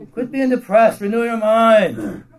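loud, high scolding, slowing on the last words; a booming, impatient God telling him off; stern rebuke. Acted. God scolding a sulking prophet.

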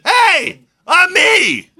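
high, loud excited cry; insistent shout. Acted. Healed blind man to doubting neighbors, insistent shout.

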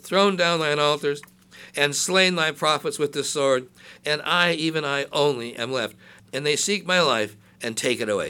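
loud, declaiming Bible reading; scripture reading. Acted. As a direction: proclaim the verses to the congregation. Reads scripture aloud.

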